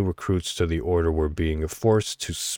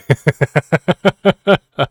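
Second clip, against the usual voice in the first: high loud gobbling laugh; turkey gobble; 8 semitones higher, 9 dB louder than usual. Pretend. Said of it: "Hope you don't mind my turkey impression".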